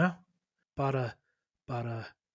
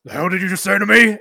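loud, higher, fast outburst; angry half-orc; 5 semitones higher, 10 dB louder, faster than usual. Acted. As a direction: confront him as an offended half-orc. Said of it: "he grabs him by his lapels".

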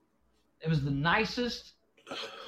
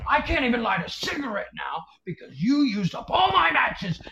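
raised, higher voice, play-acting fury; mock yell; 7 semitones higher than usual. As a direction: put on a big angry dad rant. Dad yelling at wife, acted.

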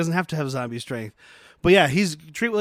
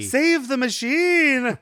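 high, slow, pleading wail; desperate plea; 8 semitones higher, much slower than usual. Retold or imitated. Vincent Price begging them to spare the machine, high slow wail. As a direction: beg for the machine.

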